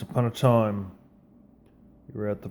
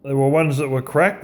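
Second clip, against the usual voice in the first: ordinary talk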